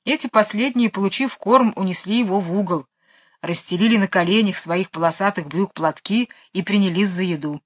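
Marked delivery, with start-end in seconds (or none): none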